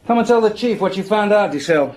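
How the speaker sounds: calling out. Acted. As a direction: call him in from outside, up and loud.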